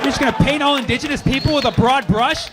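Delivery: hammed-up tirade building to a shout; mock angry rant. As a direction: tear into her like an outraged know-it-all. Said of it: "I was like".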